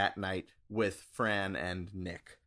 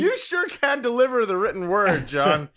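high, loud teasing through laughter; laughing; 9 semitones higher, 9 dB louder than usual. Real, not acted. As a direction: tease him while laughing. Teasing cohost after a reading.

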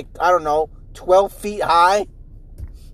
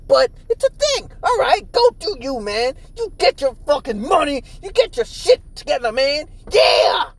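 high, sarcastic cheering on; mock encouragement; 8 semitones higher than usual. To flaky friend Forrest, mock hyped-up encouragement. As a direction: hype him up with fake enthusiasm. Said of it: "And so I'm just like".

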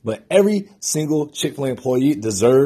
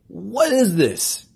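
slow amazed disbelief; 9 semitones higher, much slower than usual. Retold or imitated. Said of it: "And I'm like".

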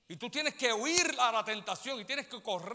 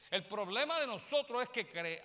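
usual speaking voice

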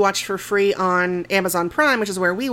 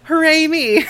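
laughing mock cheer; 7 semitones higher, 7 dB louder than usual. Real, not acted. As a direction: laugh through a sarcastic hooray. Mock self-congratulation, laughing.

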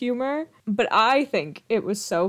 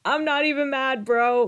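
higher and louder, delighted bro cheer; gleeful bro; 6 semitones higher, 4 dB louder than usual. Retold or imitated. Host quotes Max cheering Cole's news, gleeful bro voice. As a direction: beam with pride like a proud buddy. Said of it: "And he's like".

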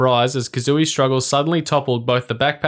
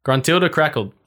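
usual speaking voice

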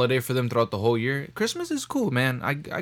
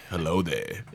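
lower, soft Obi-Wan impression; 7 semitones lower, 7 dB quieter, slower than usual. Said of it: "imagine they hit him with".

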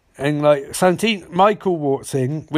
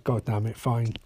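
grudging mutter; 5 semitones lower, 8 dB quieter than usual. Retold or imitated. Harrison grudgingly agreeing.

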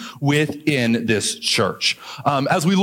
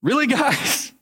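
higher, disbelieving "really, guys"; exasperated Paul; 9 semitones higher than usual. Retold or imitated. Paul exasperated with the Corinthian church, higher incredulous voice. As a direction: voice Paul's exasperation. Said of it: "I think Paul is just like".

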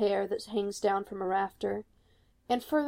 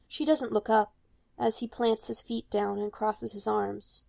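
usual speaking voice

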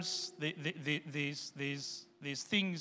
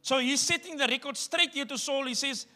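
raised, loud preacher's voice; preaching at full force; 7 semitones higher, 8 dB louder than usual. Acted. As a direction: drive the point home to the congregation.

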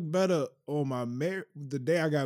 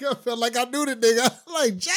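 high, laughing mock hurt; laughing disbelief; 11 semitones higher, 6 dB louder than usual. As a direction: laugh through the name. Laughing at own hurt feelings.

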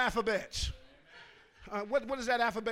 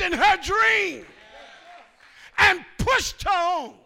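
loud, higher, drawn out sermon voice; full-force preaching; 5 semitones higher, 12 dB louder than usual. Real, not acted. Pastor exhorting the congregation, full-force preaching. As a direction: preach it to the back row.

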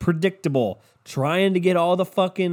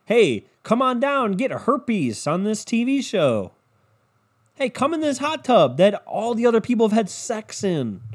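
mock TV host; 6 semitones higher than usual. Mocking TV show host invite.

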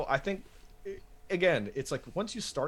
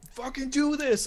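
much higher, louder pumped-up friend; hyped-up friend; 4 dB louder than usual. Retold or imitated. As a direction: egg him on like an excited buddy. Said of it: "him sitting there going, like".

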